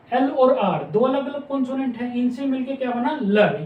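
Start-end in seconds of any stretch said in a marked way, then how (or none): 3.2-3.6 लर said as one syllable, l running straight into r; compound sound lr. Shown as a demonstration. Teacher giving students the pronunciation, saying लर. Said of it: "ये pronunciation है इनकी".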